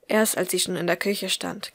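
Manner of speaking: ordinary delivery, nothing marked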